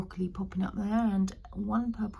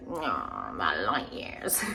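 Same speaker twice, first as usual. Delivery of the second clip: much lower, slow and drawn-out title; funny voice; slower than usual. Shown as a demonstration. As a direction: drop the voice low and drawl the title playfully. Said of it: "I said that in a funny way".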